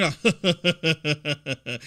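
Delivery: put-on low laugh trailing downward; imitated laugh. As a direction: mimic him laughing it off. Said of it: "like, laughing".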